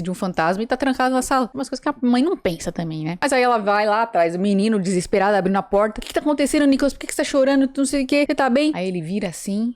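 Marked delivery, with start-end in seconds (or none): 6.0-8.7 higher-pitched, mildly frantic questioning; desperate mother. Retold or imitated. Mother checking on her crying son, mock desperate. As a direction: imitate a worried mother firing questions.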